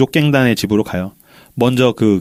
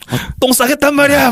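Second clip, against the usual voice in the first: very high, hammed-up urgent complaint; mock desperate whine; 13 semitones higher than usual. Joking as locked-in Tao needing the toilet, mock desperation. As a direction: play desperate for the toilet, pitch way up. Joking as the trapped boy begging out.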